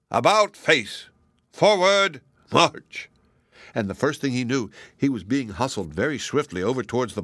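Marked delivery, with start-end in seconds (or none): 0.1-3.1 higher, louder barked commands; march the prisoner off like a sergeant; growled drill orders. A narrator's reading. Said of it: "and growled".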